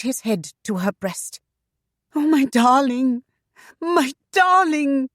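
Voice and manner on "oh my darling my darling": weeping in relief. Acted. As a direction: cry it out with relief. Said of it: "She wept in relief".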